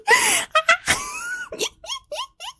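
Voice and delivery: squealing laugh that breaks up; shrieking fit of laughter; high helpless laugh. Real, not acted. Cracks up at own accent.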